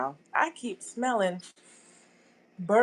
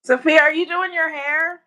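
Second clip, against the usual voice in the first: raised, higher call across the house; 7 semitones higher, 6 dB louder than usual. Real, not acted. Mother calls to her daughter Sophia in another room, loud call.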